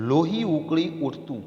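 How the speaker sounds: ordinary delivery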